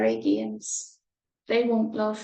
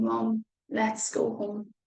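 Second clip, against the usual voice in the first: usual speaking voice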